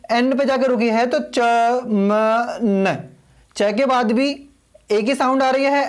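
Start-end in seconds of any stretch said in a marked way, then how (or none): 1.1-3.0 चमन stretched very slowly, ending closed on न; drawn-out demo. Shown as a demonstration. Teacher models the name for students, चमन drawn out and stopped on न. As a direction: draw out चमन syllable by syllable and stop on न. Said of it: "चमन पे जाके रुकी है".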